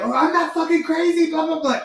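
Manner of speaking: high, indignant mimicry of a teen; imitating her daughter brushing it off; mock defensive daughter. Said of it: "my daughter's like".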